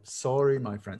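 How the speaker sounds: normal talking voice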